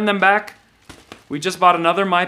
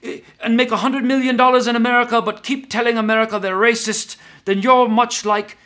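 exaggerated higher African accent with stumbling repeats; mock African accent; 6 semitones higher than usual. Pundit mocking a talk show host, in a put-on African accent. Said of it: "want to do an African accent".

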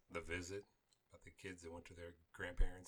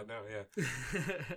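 laughing; 5 semitones higher, 7 dB louder, much slower than usual. Real, not acted. Laughing at burp-talk.

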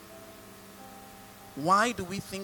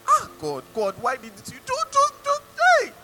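imitated angry complaint, leaping very high; mock outburst breaking into high, loud cries; angry with God; 6 dB louder, faster than usual. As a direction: rail at God in hammed-up frustration. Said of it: "He was angry. He was angry with God".

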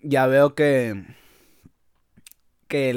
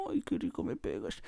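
breathy whispered mock pleasure, much softer; mock swoon; 8 semitones lower, 15 dB quieter than usual. Narrator joking he enjoyed the punches, soft mock swooning whisper. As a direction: purr it like a lovestruck fan.

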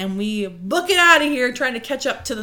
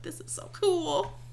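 awed; 7 semitones higher, 8 dB quieter, slower than usual. Retold or imitated. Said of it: "I was just like".

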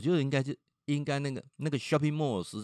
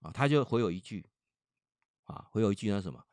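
plain reading voice

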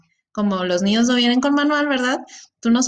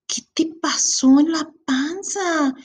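put-on slow, higher, curious little-girl voice; child's question; 5 semitones higher, slower than usual. Niece asking the pregnant aunt about her belly, slow childlike wonder. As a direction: ask it slowly like a puzzled five-year-old. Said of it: "me decía".

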